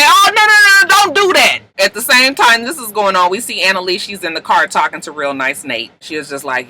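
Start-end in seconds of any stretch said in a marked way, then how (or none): 0.0-1.6 high, loud panicked mock protest; alarmed protest. Friends begging Asher not to call, alarmed protest. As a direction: rush in to stop him, voice shooting up.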